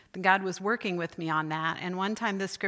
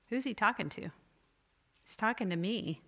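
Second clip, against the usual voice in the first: ordinary talk, nothing marked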